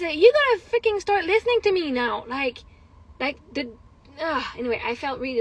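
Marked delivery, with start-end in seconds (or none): 0.2-2.3 high-pitched, fed-up parental demand; retold angry scolding. Mother fed up with being ignored, retold angry scolding. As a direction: replay telling off a child who ignores you. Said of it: "And I was pissed".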